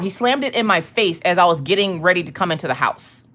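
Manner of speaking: ordinary talk, nothing marked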